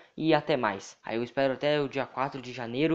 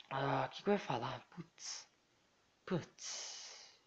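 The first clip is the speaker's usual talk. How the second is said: whispered; 12 dB quieter, much slower than usual. Real, not acted. Muttering to himself.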